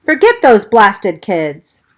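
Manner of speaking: loud, high panicked scream. Acted. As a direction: scream a warning from outside. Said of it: "he screamed".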